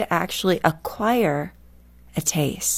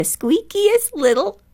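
slow, tiny squeaky voice way up high; imitating a squeaky little woman; squeaky high-pitched voice; 12 semitones higher, 3 dB louder, slower than usual. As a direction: slip into a little old-friend squeak, very high. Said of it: "squeakiest little high-pitched voice".

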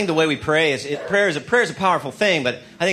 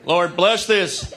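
mock pious prayer; 4 semitones higher than usual.

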